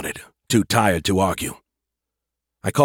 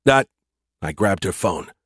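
usual speaking voice

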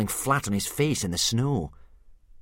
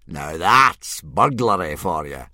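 proud gloat; 3 semitones lower, 8 dB louder, slower than usual. A narrator's reading. Gobber's proud remark.

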